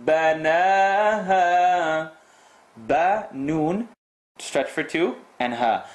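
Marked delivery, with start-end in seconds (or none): 0.1-2.1 بناها slow with a long stretched alif. Shown as a demonstration. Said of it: "It can't be a short stretch".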